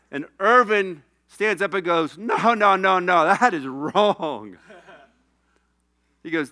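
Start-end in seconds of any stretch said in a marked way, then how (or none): 2.2-4.6 loud, drawn-out mock protest; imitated indignant outburst; retold objection. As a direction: object loudly, stretching the last words. Retold teammate standing up to object.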